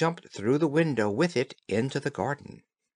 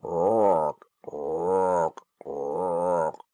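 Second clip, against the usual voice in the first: croaking toad; 6 semitones lower, much slower than usual. Acted. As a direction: croak like a big ugly toad. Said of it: "he could only cry".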